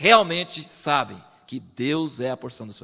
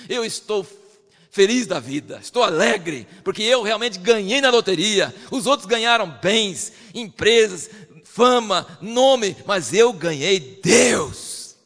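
joyful David; 4 semitones higher, 6 dB louder, slower than usual. Retold or imitated. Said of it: "Davi falou assim".

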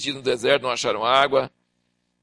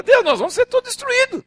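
much higher frantic plea; panicked Moses. Acted. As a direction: cry out in despair. Moses crying to God.

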